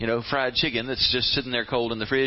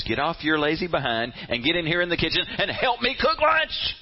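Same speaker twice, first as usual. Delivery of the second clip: building exasperated demand; 3 semitones higher than usual. Acted. Sister silently ordering Mary into the kitchen, exasperation building. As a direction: climb steadily until the last word bursts out. Acted glare turning to demand.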